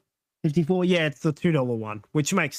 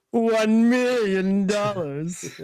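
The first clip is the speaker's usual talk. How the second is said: villain voice; 5 semitones higher, 3 dB louder, much slower than usual. Retold or imitated. Retelling villain's world ransom demand.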